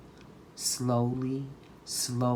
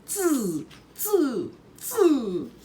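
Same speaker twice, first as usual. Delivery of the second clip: high, chattering monkey taunt; monkeys mocking; 11 semitones higher, 4 dB louder, much slower than usual. A narrator's reading. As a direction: tease him right back like a monkey. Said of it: "shook their fingers back at him and said".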